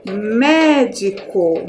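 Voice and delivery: "Médico" drawn out slowly, MÉ strongest; stress on first syllable. Shown as a demonstration. Teacher shows pupils the accented first syllable, "Médico" slow with MÉ stressed. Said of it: "A primeira sílaba que está aqui acentuada".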